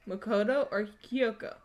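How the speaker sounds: usual speaking voice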